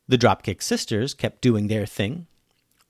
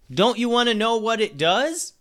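high-pitched distant holler at normal volume; 10 semitones higher than usual. A narrator's reading. Dunbar calling after the girls on the road, hollering. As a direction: call after them in a high, stretched voice. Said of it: "he hollered".

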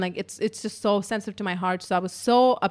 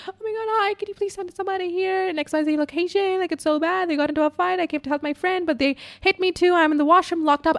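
high-pitched mock sobbing plea; retold crying; 9 semitones higher than usual. Caller begs emergency line for help, imitated tearful wail. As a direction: imitate wailing panic, words tumbling out. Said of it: "crying. So I'm like".

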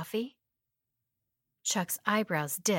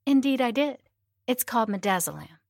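usual speaking voice

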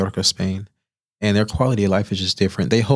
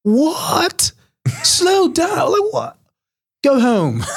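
much higher, louder mock-furious chef shouting; Gordon Ramsay outrage; 3 dB louder, much slower than usual. Gordon Ramsay yelling at a cook who wants to slow down, furious. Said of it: "Gordon Ramsay turns around and goes".

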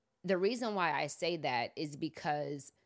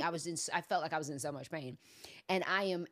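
ordinary delivery

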